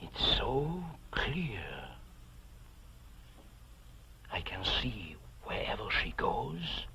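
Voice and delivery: slow hushed awe; whisper. Acted. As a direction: whisper as if entranced. Awed confession of visions.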